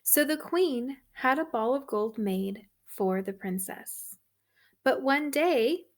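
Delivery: ordinary delivery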